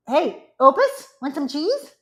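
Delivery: high, drawn-out call to a pet; slow high-pitched doggy call. Real, not acted. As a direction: coax a dog over with a treat, pitched high. Calling the other dog with treats.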